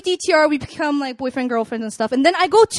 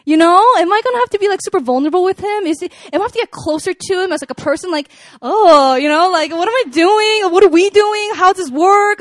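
mock panic, questions climbing higher and louder; freaking out; 5 semitones higher, 5 dB louder than usual. Woman replays fretting over a second date, freaking out. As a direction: spiral into rushed, high-pitched worry. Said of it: "I was, like, freaking out".

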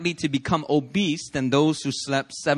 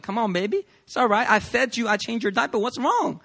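higher pleading parent voice; soothing parent; 7 semitones higher than usual. Retold or imitated. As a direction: coax a crying baby, higher. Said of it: "And you're like".

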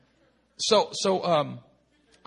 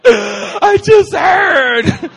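huge high drawn-out cry; mock hysterical wail, very loud and high; hysterical happy scream; 20 dB louder, much slower than usual. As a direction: shriek the big news, hammed up. Acting out people crying on calls.

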